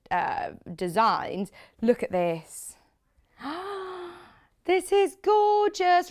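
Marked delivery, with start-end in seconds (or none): 3.4-4.1 a sharp high delighted intake of breath; delighted gasp. Real, not acted. Host seeing the next fabric, delighted gasp. 4.6-6.1 slow, much higher and louder gush. Real, not acted. Host admiring the fabric to viewers, gushing.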